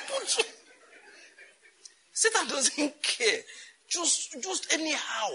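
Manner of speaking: high, slow, careless drawl; mock careless voice. Preacher mimicking a careless Satan, high mock drawl. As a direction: toss it off like someone who cares about nothing.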